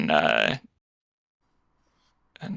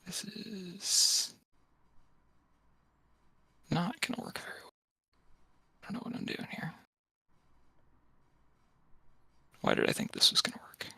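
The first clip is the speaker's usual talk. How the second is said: muttering to himself; 8 semitones higher, 9 dB quieter, faster than usual. Real, not acted. Muttering to himself over the move.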